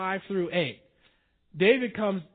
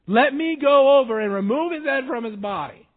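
loud, higher, forceful plea; fierce warrior; 7 semitones higher, 10 dB louder than usual. Retold or imitated. Mighty men pleading to kill Shimei, loud and forceful. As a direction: demand it like a soldier itching to fight. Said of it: "That's what it says".

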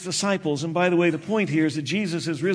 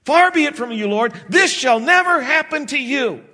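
higher and louder, a retold rebuke; rebuking; 6 semitones higher, 3 dB louder than usual. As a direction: scold him like a headstrong disciple. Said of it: "He began to rebuke Jesus".

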